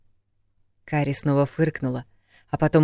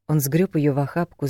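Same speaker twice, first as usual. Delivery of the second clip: ordinary delivery, nothing marked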